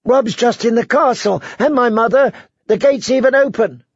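put-on high, urgent child's voice; pleading little girl. Girl insisting her family is in the castle, high and pleading.

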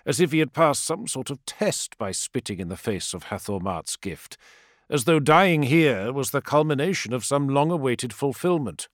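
ordinary delivery, nothing marked

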